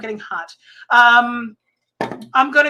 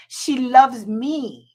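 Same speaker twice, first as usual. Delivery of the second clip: slow, stretched, a little louder; drawn-out; 2 semitones higher, 6 dB louder, much slower than usual. Real, not acted. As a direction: savour every word, slowly. Said twice, differently.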